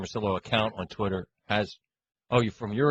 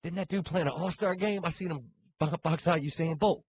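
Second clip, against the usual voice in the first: higher mock guy voice; put-on guy voice; 7 semitones higher, 3 dB quieter than usual. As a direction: tease like a pickup player.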